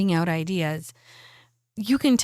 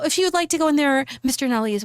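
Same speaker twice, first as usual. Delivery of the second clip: high, quick, courteous usher; polite secretary; 6 semitones higher, faster than usual. Acted. Secretary inviting guests in to see Mister Nally, polite and high-pitched. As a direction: show guests in with a raised, polite voice.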